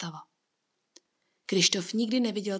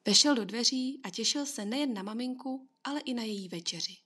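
ordinary delivery

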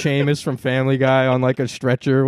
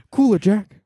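mock Biden; 8 semitones higher than usual.

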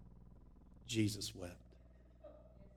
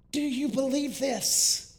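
higher, slower, ringing question; Jesus' question, preached; 8 dB louder, slower than usual. Retold or imitated. Pastor quoting Jesus' question to Martha, ringing and higher. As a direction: lift the question up to the back pew.

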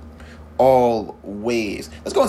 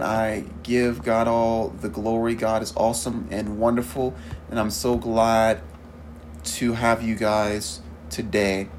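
ordinary delivery

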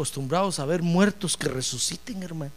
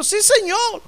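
much higher, a jump-up yes; eager high reply; 14 semitones higher, 4 dB louder, slower than usual. Acted. Marta answers Jesus eagerly.

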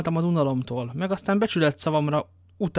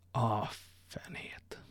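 komor suttogás; 3 semitones lower, 12 dB quieter, much slower than usual. A narrator's reading. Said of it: "szólt D'Artagnan, újból elkomorodva".

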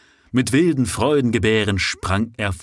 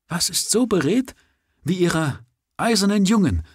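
higher, rapturous call; exclaiming; 10 semitones higher than usual. A narrator's reading. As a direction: Oberon hears the bells, exclaiming in rapture. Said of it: "rief Oberon".

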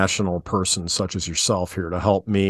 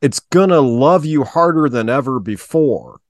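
spoken word; 3 semitones higher than usual. Pretend. Podcast host reads song lyrics for the co-host as mock spoken word. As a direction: recite it like slam poetry, lingering on each phrase. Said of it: "this spoken word segment".

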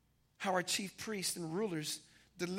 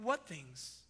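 a hint of a lower, much softer Jesus; Jesus asking gently; 6 semitones lower, 9 dB quieter than usual. Jesus gently prompting the two disciples, lightly voiced. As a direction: draw them out with a soft, knowing question. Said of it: "He's like".

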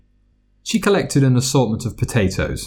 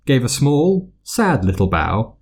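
ordinary delivery, nothing marked

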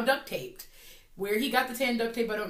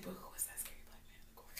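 fast hushed whisper; 3 semitones lower, 24 dB quieter, faster than usual. Retold or imitated. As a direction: whisper it like a secret. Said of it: "she's like".

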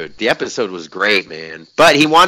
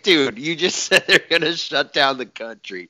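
incredulous, laughing mid-line; laughing retort; 4 semitones higher, 3 dB quieter than usual. Retold or imitated. Answering dad's nagging, laughing in disbelief. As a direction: laugh through the protest. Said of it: "And I was like".

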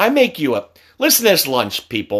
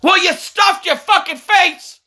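high, slow, drawn-out yell; outraged scolding; slower than usual. Real, not acted. Parent blaming kid for choking, outraged scolding. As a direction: scold at a high pitch, stretching the words.

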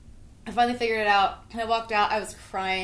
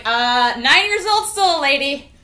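high, loud, slow sass; sassy retort; 7 semitones higher, 10 dB louder, slower than usual. Retold or imitated. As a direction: talk back with mock indignation. Kid sassing adult.

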